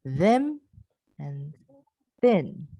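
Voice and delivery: teacher model of them and thin; voiced versus unvoiced th. Shown as a demonstration. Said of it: "let's listen".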